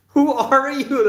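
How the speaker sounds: ordinary talk, nothing marked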